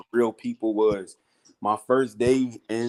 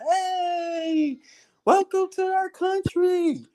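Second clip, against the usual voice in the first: much higher, slow cheerful welcome; high imitation of bus crowd; much slower than usual. Bus passengers welcoming him to Cebu, high mock cheer. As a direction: call it out like a whole bus waving.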